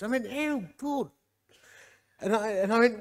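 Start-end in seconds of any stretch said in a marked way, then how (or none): 0.3-1.1 mimicked Welsh accent. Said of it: "I sort of mimic their accent".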